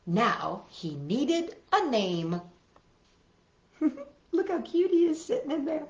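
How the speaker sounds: ordinary delivery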